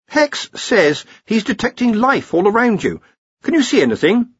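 high-voiced academic correcting his seniors; Ponder, earnest junior wizard. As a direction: explain patiently in a high voice. Ponder questions Rincewind.

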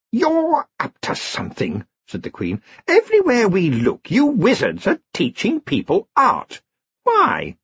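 high, slow suspicious elf queen. A narrator's reading. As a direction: probe him like a cold queen.

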